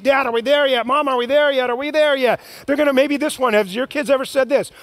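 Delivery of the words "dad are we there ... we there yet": lightly voiced child whining from back seat; a hint of a nagging kid; whiny kid. Kids shouting from back seat.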